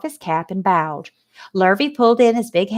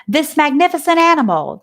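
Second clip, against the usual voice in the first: pompous announcer; 7 semitones higher, 4 dB louder than usual. A narrator's reading. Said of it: "the speaker said".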